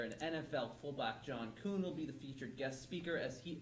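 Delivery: smooth radio ad read; announcer voice. Acted.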